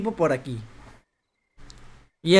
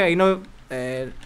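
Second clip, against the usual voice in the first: normal talking voice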